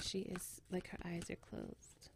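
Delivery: very soft hush; whispering. Real, not acted. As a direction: speak softly so she sleeps. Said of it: "have to whisper a little bit".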